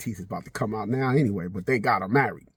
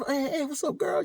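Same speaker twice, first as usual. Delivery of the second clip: casual greeting; 13 semitones higher, 5 dB quieter than usual. Retold or imitated. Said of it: "like, you know".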